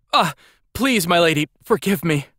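high-pitched, desperate begging; miserable pleading. A narrator's reading. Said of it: "the boy miserably sputtered excuses".

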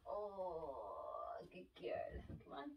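soft whisper. Real, not acted. Quietly praising baby.

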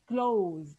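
"closed" held long and slow; term drawn out. Shown as a demonstration. Teacher naming these syllables, "closed" drawn out. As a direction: stretch out "closed" for the class. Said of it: "What do we call them?".

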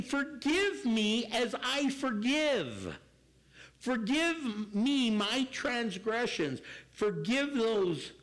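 slow, deliberate words of the prayer; slow prayer recital. Retold or imitated. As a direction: lay out each phrase slowly, like a vow. Preacher recites the prayer slowly.

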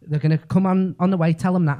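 usual speaking voice

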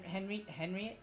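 ordinary talk

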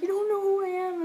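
very high, loud mock wail; imitated crying. Drunk stranger crying at not being recognised, imitated wail. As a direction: wail it, imitating someone in tears. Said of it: "completely crying".

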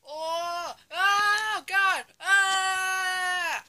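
loud shout and cry; screaming. Acted. Operator screaming inside his own machine, loud. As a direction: scream as it goes wrong.

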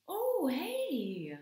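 high, loud squeaky hey; high puppet greeting. Acted. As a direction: greet them like an excited puppet.

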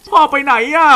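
put-on dub the child's line in a high kid voice. Shown as a demonstration. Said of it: "Where's Dad?". (เสียงเด็กแหลมสูง)

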